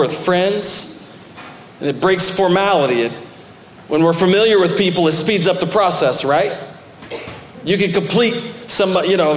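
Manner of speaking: ordinary talk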